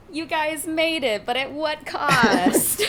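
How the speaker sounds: slow, laughing mock gravity; hammed-up ominous question, half laughing; mock dramatic. Real, not acted. GM jokes after cliffhanger.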